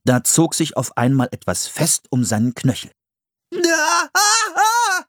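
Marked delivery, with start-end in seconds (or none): 3.5-5.0 high startled cries, ah ah ah; scream. Acted. Philipp crying out caught in a snare. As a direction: yelp as the trap jerks him up. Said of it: "schrie Philipp".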